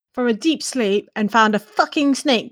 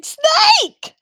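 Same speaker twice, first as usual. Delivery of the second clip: high-pitched acted scream of warning; screamed alarm; 4 dB louder than usual. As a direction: scream it out high, drawing the word out. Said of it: "I'm gonna be screaming".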